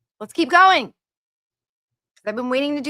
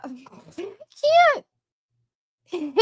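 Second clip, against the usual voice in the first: breaking into high laughter, can't get the words out; laughing; 9 semitones higher, 3 dB louder than usual. Real, not acted. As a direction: crack up mid-sentence. Laughing while reading harsh comment.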